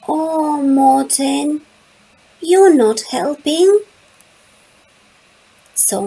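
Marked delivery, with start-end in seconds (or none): 0.1-3.8 1 mild scolding. A narrator's reading. Child scolds Morten.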